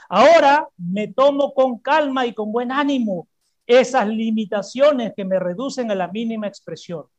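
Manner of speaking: ordinary talk, nothing marked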